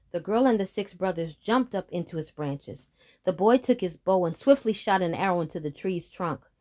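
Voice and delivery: usual speaking voice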